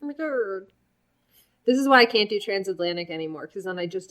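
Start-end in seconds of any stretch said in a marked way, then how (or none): 0.0-0.7 lisping Ermahgerd meme voice; Irma Gerd voice. Retold or imitated. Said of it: "when I slip into the Irma Gerd voice".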